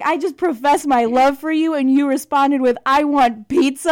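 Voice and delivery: loud and high, indignant; mock outrage. Quoting her indignant reply to the boyfriend, loud and high. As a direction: huff it out in disbelief.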